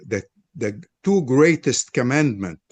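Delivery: ordinary talk, nothing marked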